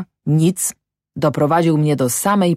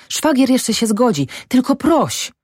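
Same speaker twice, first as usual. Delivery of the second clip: put-on breathless young master; 9 semitones higher than usual. A narrator's reading. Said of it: "mówił zadyszany".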